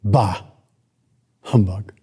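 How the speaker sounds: a hint of sour old Scrooge; grumpy Scrooge. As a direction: spit it out with contempt. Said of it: "Scrooge's response was".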